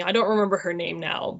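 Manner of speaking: usual speaking voice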